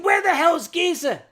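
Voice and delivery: loud, high demand; angry child confronting a stranger on mom's phone; angry yell. Retold or imitated. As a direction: bark at the stranger on the line.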